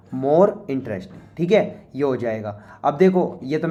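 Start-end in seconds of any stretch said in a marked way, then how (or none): 0.1-1.4 more interesting said very slowly; slow dictation. Shown as a demonstration. Teacher repeating the answer slowly for students to copy. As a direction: drag out more interesting so they can write it. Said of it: "repeating the answer slowly".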